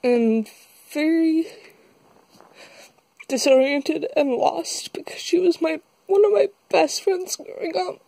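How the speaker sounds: tearful, high, breaking voice; fighting back tears while talking; after crying. Real, not acted. Breaks down telling of her mother.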